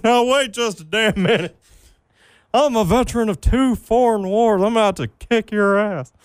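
high, indignant Texan cartoon voice; Tom Anderson. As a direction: threaten like an outraged old Texas veteran. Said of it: "favorite quotes from Tom Anderson".

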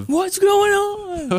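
high, slow goofy drawl; silly high voice. Pretend. Silly mock question in banter.

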